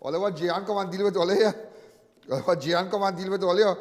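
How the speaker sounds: usual speaking voice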